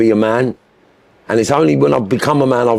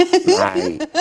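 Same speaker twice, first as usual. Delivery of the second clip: laughing mimicry. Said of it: "that's what they do".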